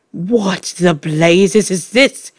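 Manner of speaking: slow, put-on indignant voice, restrained yell; understated outraged yell. A narrator's reading. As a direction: demand it word by word. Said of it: "yelled the Trenchbull".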